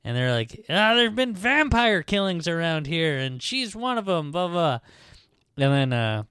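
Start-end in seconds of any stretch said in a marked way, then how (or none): 0.7-4.8 high-pitched mock villager explaining; villagers imitated. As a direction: lightly play an agitated local.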